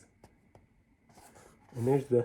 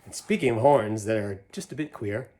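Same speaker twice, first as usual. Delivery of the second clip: put-on lively read-aloud to kids; animated picture-book reading; story narration; 7 semitones higher, 6 dB louder than usual. A narrator's reading. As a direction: read the rhyme with a bouncy lilt. Reads Seuss poem to children.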